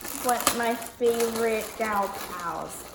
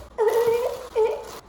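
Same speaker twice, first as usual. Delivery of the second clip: high, cry-like laugh; high whimpering giggle; squealy laugh; 11 semitones higher, 4 dB louder than usual. Real, not acted. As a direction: squeal with delight. Laugh after jeans.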